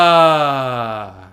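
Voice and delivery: long, loud open sigh on ah; relaxed sigh. Shown as a demonstration. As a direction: let the breath fall out freely on a sigh. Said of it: "the next thing we're going to add is a sigh".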